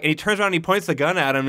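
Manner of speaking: ordinary delivery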